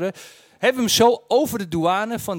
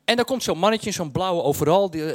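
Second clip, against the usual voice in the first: usual speaking voice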